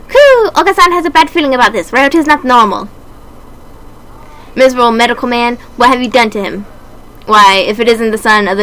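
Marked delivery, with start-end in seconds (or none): 0.1-2.9 high birdlike voice, fast and cooing; a nervous dove sensing trouble; uneasy pigeon character. Acted. Pigeon Oka-san sensing trouble with Ryota, high and quick.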